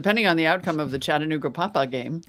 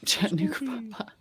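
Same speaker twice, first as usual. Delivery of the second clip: whispered; 5 semitones higher, 8 dB quieter, faster than usual. Acted. Whispered name.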